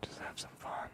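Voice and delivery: soft unvoiced whisper. Real, not acted. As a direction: breathe it close to the mic. ASMR whisper to viewers.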